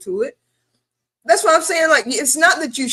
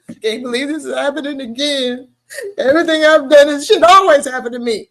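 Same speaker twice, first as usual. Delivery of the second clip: hammed-up wail building louder; mock frustrated crying; 3 semitones higher, 4 dB louder than usual. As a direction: imitate a self-pitying sob, then push it louder. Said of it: "But we have cries like".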